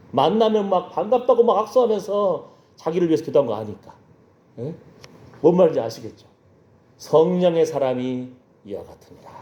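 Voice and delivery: ordinary talk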